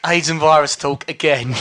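loud mock-weary audience voice; loud, hammed-up complaint; fed-up listeners. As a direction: imitate listeners tired of the same topic. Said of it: "it'll be more like".

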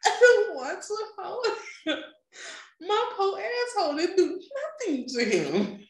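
high, laughing through it. Real, not acted. Telling a friend about refusing the baby, laughing high. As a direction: giggle the refusal.

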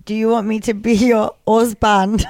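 put-on Nigerian accent, "husband" without H; mock Nigerian accent. Host teasing co-host about ex-boyfriend, mock Nigerian accent. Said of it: "Nigerian accent".

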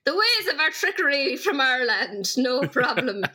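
playfully laid-on Irish lilt; mock-mysterious Irish storyteller; hammed-up Irish. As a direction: lean into the Irish accent for a joke. Playing up Irish trickery.

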